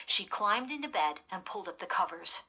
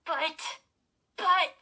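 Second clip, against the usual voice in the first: high, halting protest, getting louder; sputtering; 11 semitones higher, 8 dB louder than usual. A narrator's reading. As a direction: stumble on it, stunned and upset. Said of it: "she sputtered".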